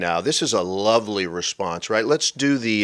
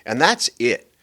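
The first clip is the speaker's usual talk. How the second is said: usual speaking voice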